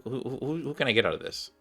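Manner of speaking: ordinary talk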